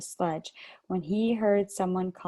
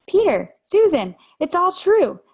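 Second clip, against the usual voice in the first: much louder, higher excited blurt; bursting out; 10 semitones higher, 9 dB louder than usual. A narrator's reading. Lucy bursting in on her siblings, excited shout. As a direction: burst it out breathlessly. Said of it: "Lucy burst out".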